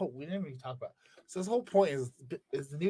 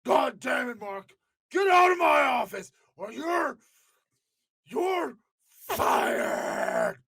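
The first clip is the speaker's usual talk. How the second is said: much higher, loud firing shout; Vince impression; 9 semitones higher, 3 dB louder, much slower than usual. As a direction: scream that he's fired. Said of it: "Fucking Vince is hard to do".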